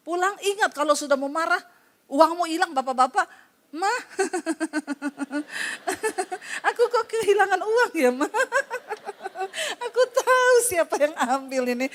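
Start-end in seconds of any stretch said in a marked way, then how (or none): 3.7-11.9 high, laughing husband to wife. Retold or imitated. Husband tells his wife he lost money, laughing and higher. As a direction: chuckle through the bad news, higher.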